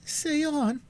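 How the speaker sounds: put-on high, pressing young voice; eager cry. A narrator's reading. Alice urging Cora to go on, high and anxious. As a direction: urge her on, high and anxious. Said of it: "cried Alice".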